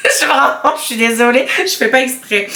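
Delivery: high, helpless giggly apology; laughing apology. Real, not acted. Vlogger sorry for botching the name, laughing helplessly. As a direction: apologise while struggling not to laugh.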